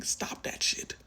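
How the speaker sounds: breathy whisper of the same scold; whispered repeat. Real, not acted. Reader repeating the scold to the viewer, whispered. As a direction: hush it out under the breath.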